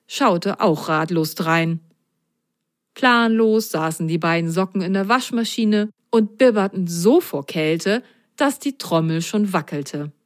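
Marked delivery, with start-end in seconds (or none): none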